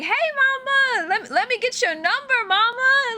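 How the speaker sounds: high, drawn-out mock come-on; exaggerated street pickup voice; mock catcaller. Imitating a catcalling stranger.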